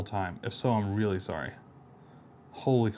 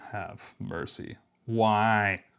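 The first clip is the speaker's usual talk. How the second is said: ordinary talk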